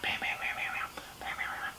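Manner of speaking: soft whispered bam bam; hushed imitation of tapping on glass. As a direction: whisper the knocking. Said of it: "whispered sound effect".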